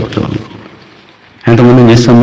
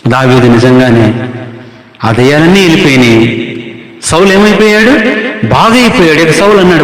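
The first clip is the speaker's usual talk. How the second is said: usual speaking voice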